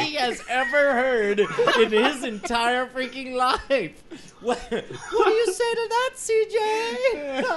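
high-pitched, breaking up with laughter; laughing hard. Real, not acted. Host quizzes C J on his joke, helpless with laughter. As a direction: struggle to get it out through laughter. Cracking up retelling C J's line.

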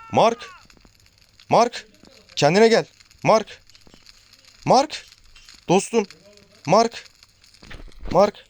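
high-pitched calls of a friend's name, rising at each end; calling out. Pretend. Gamer shouting high to his fallen in-game friend Mark, pleading. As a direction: yell for him to wake up, pitch climbing high.

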